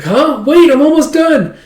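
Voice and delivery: put-on high, loud, girlish urgency; urgent protest. Girl sewing as the man leaves, urgent protest. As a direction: call out to stop someone from going.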